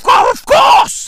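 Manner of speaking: high loud mock wail. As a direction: overdo it like fake grief. Mock emotional outburst.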